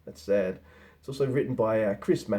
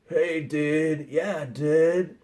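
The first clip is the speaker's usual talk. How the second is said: higher-pitched California stoner drawl; 4 semitones higher, much slower than usual. Acted. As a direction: imitate two stoner dudes greeting each other. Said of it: "That's how they talk".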